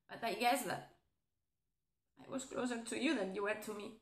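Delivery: low, soft and sad; wounded confession. Acted. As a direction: admit the hurt quietly. Admits hurt after breakup.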